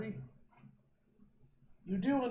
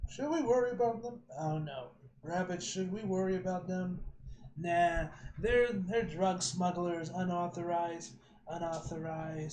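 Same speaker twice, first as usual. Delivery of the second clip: usual speaking voice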